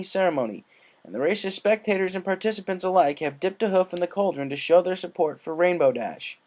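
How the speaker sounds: excited dragon announcer; race announcer. Acted. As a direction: boom it out over the crowd. Spike commentary.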